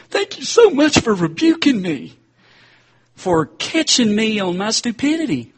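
high, loud sarcastic thank-you; mock gratitude. Preacher mocking a churchgoer, fake grateful high voice. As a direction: gush fake thanks for being scolded.

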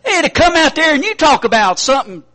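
high, loud, ringing pulpit voice; full-force preaching. Acted. Preacher thundering to the congregation about the graveyard. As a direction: preach it out to the back row.

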